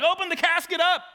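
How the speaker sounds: high shouted command, hammed up; a comically loud, high demand; mock shouted order. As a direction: bark it out like an outrageous order. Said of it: "he's like".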